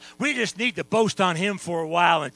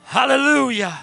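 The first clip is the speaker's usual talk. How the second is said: loud calls to praise; shouted hallelujahs from the pulpit; exultant preaching; 5 dB louder than usual. Real, not acted. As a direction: rouse the whole congregation. Preacher rousing congregation to praise.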